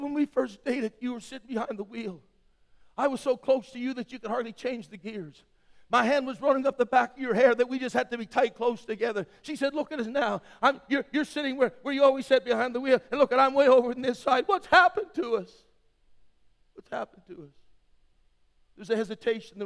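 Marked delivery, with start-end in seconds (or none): 0.0-14.4 a hint of a hurt wife, higher and louder; wife pleading. Wife's complaint in story. 14.5-15.5 "What's happened to us?" high and loud, pleading; anguished cry. Retold or imitated. Wife's cry, then repeated softly.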